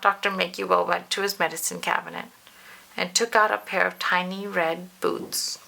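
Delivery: ordinary delivery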